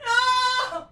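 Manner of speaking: very high shrieks, slow laugh-crying afterward; scared scream. Real, not acted. Streamer jump-scared by a horror game, shrieking and laugh-crying. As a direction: shriek and laugh-cry in fright.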